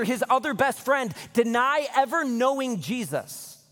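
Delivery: rising sermon intensity; full-force preaching. Acted. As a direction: build the scene up for the church.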